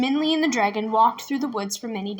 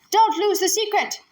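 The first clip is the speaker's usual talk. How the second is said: yelling; 7 semitones higher than usual. Acted. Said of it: "despite his yells".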